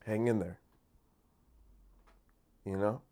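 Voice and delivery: soft, low, gentle brush-off; calm quiet. Shown as a demonstration. Answering the outraged critic, soft calm reassurance. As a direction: soothe them calmly. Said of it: "And I just say".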